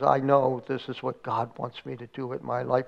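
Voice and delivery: low, soft, subdued retelling; mild tearful resignation, voice dropped; retold weeping. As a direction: quietly act out a weeping man accepting his call. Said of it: "And he's weeping".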